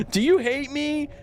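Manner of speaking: high, mock-needy kid voice; needy middle schooler. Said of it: "this middle school text message thing".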